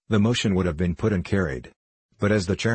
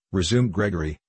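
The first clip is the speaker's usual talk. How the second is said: usual speaking voice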